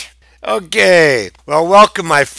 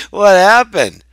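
mock shocked concern; 6 semitones higher, 10 dB louder than usual. Mock concern for the battered hippo puppet.

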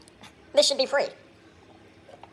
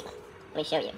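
whisper; 5 semitones lower, 12 dB quieter than usual. Real, not acted. Whispered aside.

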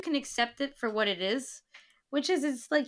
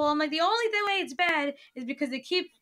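ordinary talk, nothing marked

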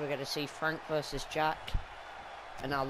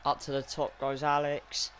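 ordinary delivery

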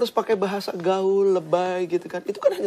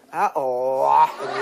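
casual youth talk; 3 semitones lower, 3 dB louder, slower than usual. Retold or imitated. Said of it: "ketika sama mereka".

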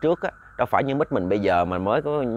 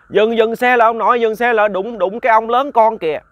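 much higher, louder panicked shout; child screaming; 9 semitones higher, 6 dB louder than usual. A narrator's reading. Child screaming at grandfather to stop the bicycle, panicked shout. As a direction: yell at grandpa to stop the bike. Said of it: "nó la làng lên".

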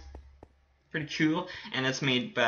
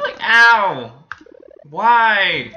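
higher, loud, drawn-out wail; loud protest; 5 semitones higher, 10 dB louder, much slower than usual. Real, not acted. Gamer hurt in the game, loud protest. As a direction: wail it out loudly.

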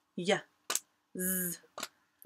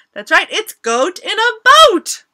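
excited; 9 semitones higher, 13 dB louder than usual. Acted. Phonics rhyme cheer.

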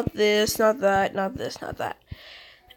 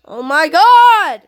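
disgusted shout; 6 semitones higher, 10 dB louder, slower than usual. Real, not acted. Disgusted at the drinking.

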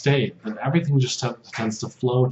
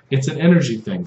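ordinary delivery

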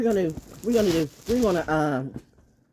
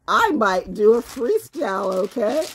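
ordinary talk, nothing marked

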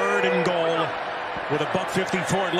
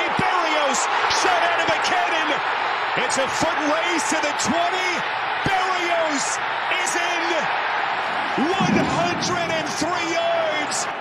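touchdown call; 8 semitones higher than usual. Real, not acted. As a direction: build with the runner into the score.

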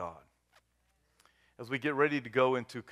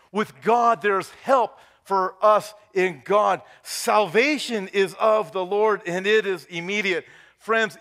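raised, loud altar-call preaching; full-force preaching; 6 semitones higher, 8 dB louder than usual. Real, not acted. Pastor urges congregation to repent before communion, preaching hard. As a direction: proclaim salvation with rising urgency, then ease slightly.

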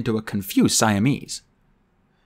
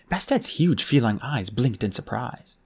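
ordinary delivery

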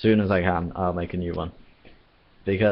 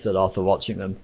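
ordinary delivery, nothing marked

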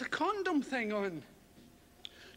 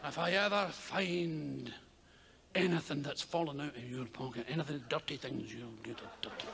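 stern mother; 11 semitones lower, 5 dB quieter than usual. Retold or imitated. Mother warns son about dirty things in a low, fading voice. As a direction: warn him darkly and sink lower each word.

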